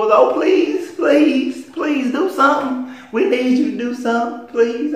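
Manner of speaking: very high, drawn-out begging; mocking whine. People running to stars to beg, high whiny mock pleading. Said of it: "So don't sit whining".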